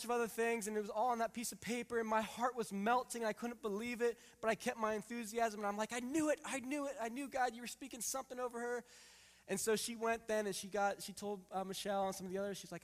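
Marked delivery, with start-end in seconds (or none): 5.9-8.8 thrilled inner voice. Retold or imitated.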